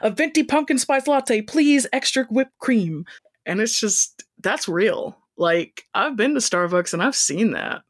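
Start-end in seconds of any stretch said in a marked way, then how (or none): 0.0-3.1 higher, eager rush of an order; eager blurt. Retold or imitated. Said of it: "Melissa blurted out".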